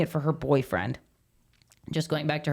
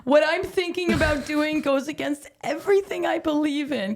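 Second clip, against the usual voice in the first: high mock girl voice; 10 semitones higher than usual. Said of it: "she's like".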